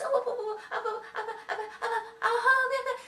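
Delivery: high, flustered stammering; scared Goldilocks. A narrator's reading. Goldilocks doesn't know what to say, high scared stammer. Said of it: "she gets so scared".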